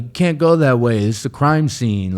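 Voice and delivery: mocking cop. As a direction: mimic the cop who shuts him down.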